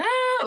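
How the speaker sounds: high imitated child wail; a high startled cry like scared kids; mock wail of frightened kids. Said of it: "they started crying".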